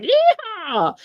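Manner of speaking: high hammed-up cowboy whoop; sarcastic mock yokel cry; mock yeehaw. Mocking imagined rural gun shooters.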